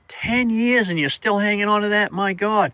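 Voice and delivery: mock astonished at ten years; disbelief. Storyteller reacting to the old lady, retold mild disbelief.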